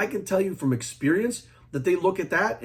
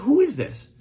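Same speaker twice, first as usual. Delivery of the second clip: high, sharp, louder "who is this"; incredulous; 9 semitones higher, 4 dB louder than usual. Retold or imitated. Caught on a tapped call, demanding who this is, incredulous. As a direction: snap it back like you've never heard of him. Retold shocked reply on the phone.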